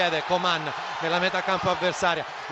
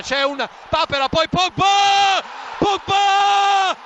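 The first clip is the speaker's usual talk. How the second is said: screaming the goal, higher and louder; breathless italian goal commentary; 10 semitones higher, 6 dB louder, slower than usual. Real, not acted. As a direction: ecstatic goal call, stretch the name. Goal call for Juventus. (telecronaca urlata del gol)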